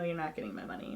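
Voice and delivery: ordinary talk, nothing marked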